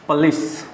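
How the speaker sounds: पुलिस said with a light first vowel, "puh-lees"; correct "police". Shown as a demonstration. Teacher models the correct "puh-lees". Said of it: "इसका सही उच्चारण होता है".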